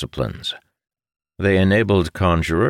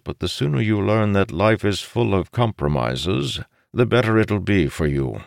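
counselling father; 6 semitones higher than usual. Acted. Voices the father counselling his son.

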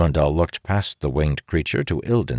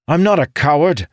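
indignant; 12 semitones higher, 7 dB louder than usual. A narrator's reading. Amandal protesting loudly.

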